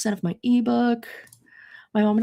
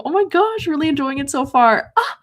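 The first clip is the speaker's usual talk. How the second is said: excited; 6 semitones higher, 4 dB louder than usual. Real, not acted. Excited reaction to viewer's comment.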